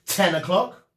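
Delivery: plain reading voice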